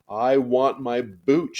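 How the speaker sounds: put-on loud, high, slow childish howl; a little girl howling for her boots. A narrator's reading. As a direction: howl on the edge of tears. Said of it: "she howled".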